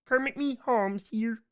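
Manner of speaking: high puppet frog voice; slow, high cartoon frog impression; Kermit. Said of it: "Yep, that one".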